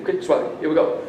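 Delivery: plain reading voice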